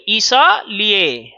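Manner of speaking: "इसलिए" slowly as i-sa-li-e, the a sounded; correct pronunciation. Shown as a demonstration. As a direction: stretch "इसलिए" with a clear sa. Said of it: "इसलिए नहीं".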